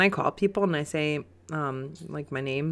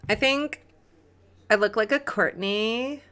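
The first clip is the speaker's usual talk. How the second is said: put-on higher-pitched Valley Girl uptalk; 5 semitones higher than usual. Host picking a new name, mock Valley Girl voice. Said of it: "I made a Valley Girl voice".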